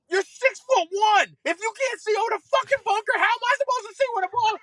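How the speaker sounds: high-pitched exasperated yell, racing after the first words; comic retold outburst, voice shooting way up; snapped. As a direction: blow up in squealing disbelief. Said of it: "but then I snapped".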